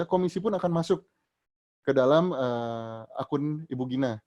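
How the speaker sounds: ordinary talk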